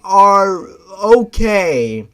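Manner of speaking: loud, high, slow stuttering protest; stammering protest. Acted. Girl refusing Ben's pet name, loud stammering protest. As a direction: snap back through the stutter.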